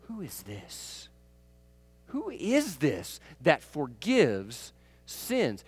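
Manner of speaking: slow, low, hushed wonder; awed murmur. Retold or imitated. Dinner guests marvelling at Jesus forgiving sins, slow and hushed. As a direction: ask it slowly in hushed awe. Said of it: "looked at him and said".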